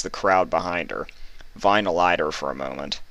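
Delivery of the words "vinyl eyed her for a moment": ordinary delivery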